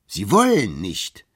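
loud, slow disbelieving question; stunned, drawn-out disbelief; incredulous. Acted. Lawyer shocked by Bartleby's refusal, loud slow disbelief.

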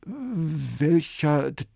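slow, halting nervous question; trembling voice. A narrator's reading. Sven asks the man, voice trembling. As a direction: stumble over the words in fear. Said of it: "mit zittriger Stimme".

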